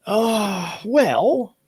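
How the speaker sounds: high, loud, drawn-out stalling; retold awkward hesitation. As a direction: imitate a doctor stalling before bad news. Doctor reluctant to give bad news.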